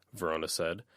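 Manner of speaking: plain reading voice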